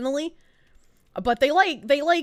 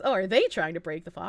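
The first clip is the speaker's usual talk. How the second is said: normal talking voice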